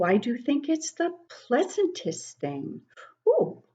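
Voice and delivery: ordinary talk, nothing marked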